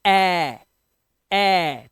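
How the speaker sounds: ए as an open "ae", held out and repeated; short A sound. Shown as a demonstration. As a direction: stretch each ए as the vowel in bat. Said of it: "इसे पढ़ोगे ए". (ए को खुली "ऐ" की तरह खींचें)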